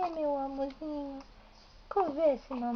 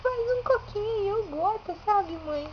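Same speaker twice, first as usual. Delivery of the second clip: put-on high, giggly little-baby voice asking for tickles; 5 semitones higher than usual. Mother speaking as her baby to herself, high and giggly. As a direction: squeak it out like a delighted baby.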